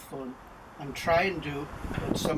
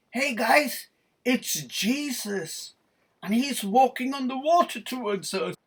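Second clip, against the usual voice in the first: high, amazed shout to friends; excited calling out; 8 semitones higher, 4 dB louder than usual. Acted. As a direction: call out an astonishing sighting. Man in boat alerts his friends.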